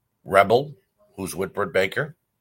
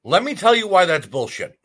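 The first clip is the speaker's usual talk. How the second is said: louder and higher, fired-up call-out; a ranter who finally lets loose; heated outburst; 8 semitones higher, 6 dB louder than usual. Real, not acted. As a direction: start the rant hot. Launching into a rant at Lana.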